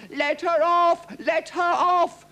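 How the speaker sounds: very high, loud chanted plea; crowd shouting. A narrator's reading. Crowd begging the king to spare Rosalba, shouting. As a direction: cry out like a protesting mob. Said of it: "the crowd shouted".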